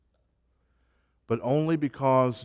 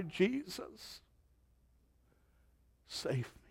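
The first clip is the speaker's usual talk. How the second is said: hushed, acted plea for rescue; imagined desperate cry; 7 semitones higher, 10 dB quieter, slower than usual. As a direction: softly act out a cry for help. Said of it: "and they would cry".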